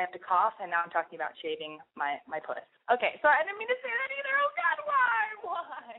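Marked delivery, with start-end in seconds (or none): 2.9-5.9 very high, strained cringing voice; squeaky embarrassment. Real, not acted. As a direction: shriek at yourself in mortified disbelief. Embarrassed at her own words.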